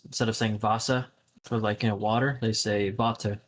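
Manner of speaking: ordinary talk, nothing marked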